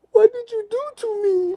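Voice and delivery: very high mock-tearful accusation; hysterical woman impression. Man imitating the drugged woman accusing him, high hysterical wail.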